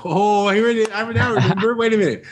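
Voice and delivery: high, surprised oh I remember; sudden recall. Real, not acted. Interviewee suddenly remembering, sudden high surprise. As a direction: pitch jumps up as the memory hits.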